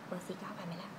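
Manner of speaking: whispered aside to herself; a hushed question while trying colours. Real, not acted. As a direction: breathe it out, unvoiced. Aside while testing lipstick.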